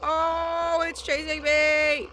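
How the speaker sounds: high, slow panicked cry; chased, real fear in the voice; scared horror game streamer. Real, not acted. Chased by a monster.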